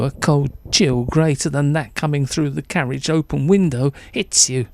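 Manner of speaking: ordinary delivery, nothing marked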